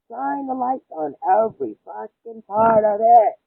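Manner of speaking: loud, high and slow, word by word; forceful rallying rant; emphatic angry shout. Real, not acted. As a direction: shout it out, stretching the key words. Ranting about exposing the wrong.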